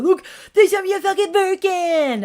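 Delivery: high, loud mock brag; mocking bragger. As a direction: show off like a smug influencer. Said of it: "I'm not gonna brag about".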